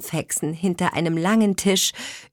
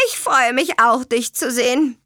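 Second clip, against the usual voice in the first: high, huffy sarcastic croak; offended croak; 7 semitones higher, 3 dB louder than usual. A narrator's reading. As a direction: sound hurt and sarcastic. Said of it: "krächzte die Rabenhexe beleidigt".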